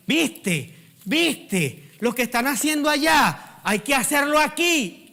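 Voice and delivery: high, loud mock excitement, building; excited trend-follower. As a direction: imitate someone breathlessly pushing the latest trend, pitch climbing. Mimics people chasing social media trends. (imitación exaltada de quien sigue las modas, voz aguda)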